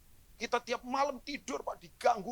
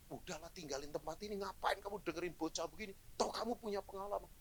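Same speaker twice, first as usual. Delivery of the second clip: hushed tempting thought, much softer; soft inner voice; 8 dB quieter than usual. Retold or imitated. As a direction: murmur it like a voice in the heart. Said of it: "tiba-tiba di hati saya". (suara hati yang lirih menggoda)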